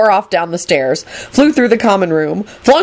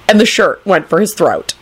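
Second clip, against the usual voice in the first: ordinary delivery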